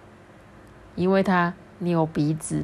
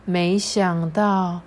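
ordinary delivery, nothing marked

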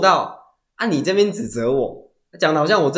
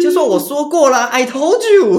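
high, loud mocking told-you-so; 12 semitones higher, 8 dB louder than usual. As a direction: rub it in loudly. (得意地说“早就告诉你了”)

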